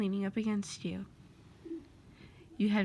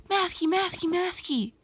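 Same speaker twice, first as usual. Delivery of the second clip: much higher, louder sing-song calls; annoying calling out; 9 semitones higher, 4 dB louder than usual. Acted. Toby annoying Masky, calling his name over and over. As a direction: pester him by yelling his name.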